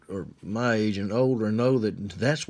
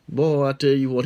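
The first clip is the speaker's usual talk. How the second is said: ordinary delivery, nothing marked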